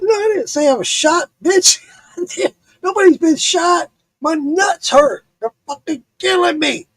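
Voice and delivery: high-pitched indignant retort; exasperated denial. Retold or imitated. Retold indignant denial to dispatcher.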